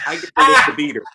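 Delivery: put-on loud, cheeky old grandma; hammed-up randy grandma line. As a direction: belt it out like a frisky grandma. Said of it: "and she goes".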